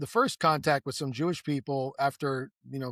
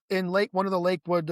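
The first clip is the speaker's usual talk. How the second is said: usual speaking voice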